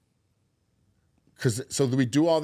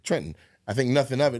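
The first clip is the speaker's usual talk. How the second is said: ordinary talk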